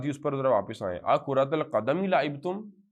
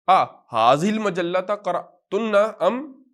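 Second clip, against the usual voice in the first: slow, loud, rising question, stretching the opening آ; drawn-out question; 7 semitones higher, 8 dB louder, slower than usual. Shown as a demonstration. Teacher modelling the question to students, slow and loud. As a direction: lean on آ and let قرأتن rise like a question. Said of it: "کریں".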